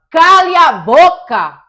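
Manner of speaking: bellowed command, every word hit hard; aggressive shout. Shown as a demonstration. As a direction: shout it as a hostile order. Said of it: "A partir do momento ... chego pra você e falo".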